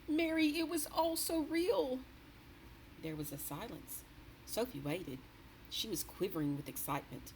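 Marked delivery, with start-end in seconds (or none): 0.1-2.0 higher, shaken royal voice; a grand lady still rattled by a nightmare; the queen's voice. A narrator's reading. The queen telling her maid a frightful dream, shaken.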